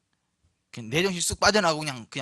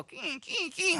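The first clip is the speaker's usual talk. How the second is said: high whimpering yelps of a dog; 12 semitones higher, 5 dB quieter, much slower than usual. Retold or imitated. Preacher imitates a mad dog backing down, high whimper. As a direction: imitate a cowed dog's whine.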